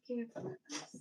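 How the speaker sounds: breathy whispered repeat of care for us; whispered echo. Pretend. Soft whisper. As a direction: she echoes her own motto to viewers in a whisper.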